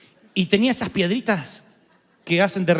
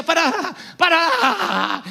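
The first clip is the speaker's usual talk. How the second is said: laughing plea; 9 semitones higher, much slower than usual. Retold or imitated. Retelling Liliana's plea while driving.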